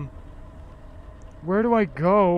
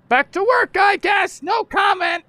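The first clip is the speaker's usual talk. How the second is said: loud sarcastic; 7 dB louder than usual. Real, not acted.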